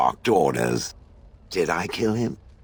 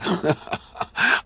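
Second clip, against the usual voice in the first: breathy laugh; 12 semitones higher than usual. Real, not acted. Amused at reciting limericks.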